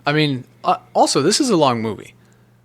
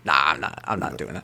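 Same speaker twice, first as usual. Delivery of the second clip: dismissive; 10 semitones lower, 6 dB quieter than usual. Retold or imitated. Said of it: "He was just like".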